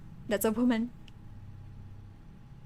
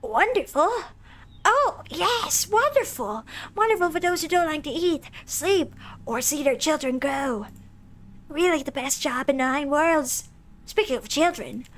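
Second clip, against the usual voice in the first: high, sarcastic demon mother voice; Lady Mance voice; 4 semitones higher than usual. Acted. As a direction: drip sarcasm in a higher voice. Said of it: "doing her voice".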